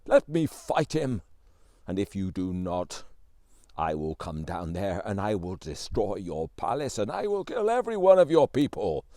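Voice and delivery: loud menacing giant threat, swelling at the end; giant bellowing. A narrator's reading. Giant threatening the whole palace, loud menacing bellow. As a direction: roar out the threat to destroy the palace. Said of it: "bellowing at the top of his voice".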